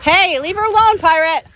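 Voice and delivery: high, loud telling-off; scolding shout. Real, not acted. Owner telling Pirate to leave the hen alone, scolding shout. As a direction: call out sharply to break it up.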